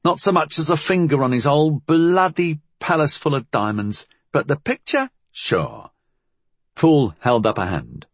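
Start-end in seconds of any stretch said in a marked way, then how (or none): 0.0-5.9 put-on higher, sarcastic street girl; scornful young Cockney girl. A narrator's reading. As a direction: sneer at the plan. Molly grumbles about the plan.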